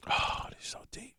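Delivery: unvoiced-sounding real whisper, very soft; hushed aside. Real, not acted. Guest struck by his own plantation story, hushed whisper. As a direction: whisper it to yourself, weighed down.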